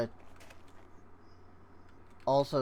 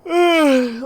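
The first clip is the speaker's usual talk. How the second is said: loud groan; 13 semitones higher, 14 dB louder than usual. Real, not acted. Frustrated while searching.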